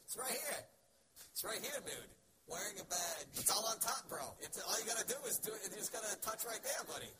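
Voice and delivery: high, loud, insistent dude voice; pushy TSA agent. Acted. Airport agent urging a stuck traveler, high insistent mock voice. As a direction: press the point excitedly, pitch way up.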